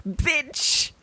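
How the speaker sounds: a sharp, high, rising outraged cry; outraged exclamation. Real, not acted. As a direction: spit it out at him in disbelief. Outraged at the character's hypocrisy.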